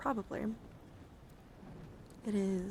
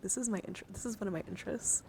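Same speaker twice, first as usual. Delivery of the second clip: ordinary delivery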